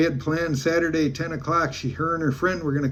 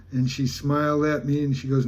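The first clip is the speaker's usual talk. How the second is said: ordinary talk, nothing marked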